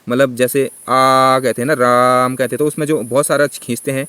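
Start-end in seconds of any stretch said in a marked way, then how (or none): 0.6-1.4 आ held for a long time; आ drawn out as a pluta vowel. Shown as a demonstration. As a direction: stretch the vowel आ out, slowly. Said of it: "आ की मात्रा को बहुत ज़्यादा खींचते हैं".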